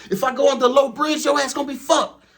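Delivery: high, loud gruff trainer voice; yelling trainer. Retold or imitated. Trainer yelling at the new driver, loud and high. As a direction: bark it like an angry trucker boss.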